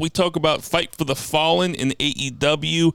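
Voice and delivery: punchy podcast announcer read; show announcer. Acted. Host announces tonight's podcast topics to listeners, announcer style. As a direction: hype up tonight's line-up. Reads the show's opening rundown.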